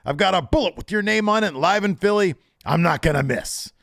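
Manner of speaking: high, loud cocky wrestling promo threat; menacing promo. Retold or imitated. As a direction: cut a heel promo at the camera. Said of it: "M J F turns the camera and says".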